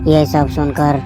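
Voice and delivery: ordinary delivery, nothing marked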